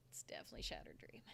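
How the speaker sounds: secretive whisper of the same answer; whispered aside. Real, not acted. Telling viewers a favorite, whispered conspiratorially.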